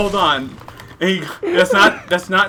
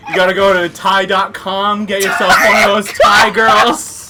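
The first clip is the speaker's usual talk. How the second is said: loud mock quote; 5 semitones higher, 8 dB louder than usual. Quoting stranger, loud and excited.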